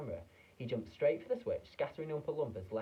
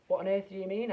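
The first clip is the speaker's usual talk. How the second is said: high, loud sharp question; alarmed father demanding an answer; 9 semitones higher, 9 dB louder than usual. A narrator's reading. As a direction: snap it at him. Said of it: "Mister T V sharply".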